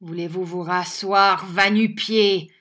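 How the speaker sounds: loud order, dragging out the insult; enraged cry. A narrator's reading. Schoolmaster beside himself at the young teacher, enraged cry. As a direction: order him down in anger. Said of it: "cria Squeers hors de lui".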